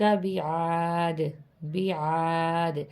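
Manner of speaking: بعاد with the final د bouncing back, slowly; echoing dal at the stop. Shown as a demonstration. Teacher showing the echoing dal to students. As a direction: stop on بعاد and let the د echo. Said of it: "آواز دوبارہ پلٹ کے دال کی آواز نکلے گی".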